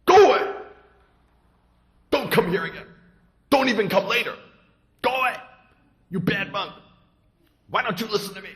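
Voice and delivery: shouting high and loud at the monk; furious shout. Acted. Angry attendant shouting the stranger away from the gate. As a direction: drive him off with a yell. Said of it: "very angry".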